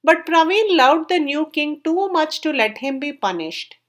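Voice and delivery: usual speaking voice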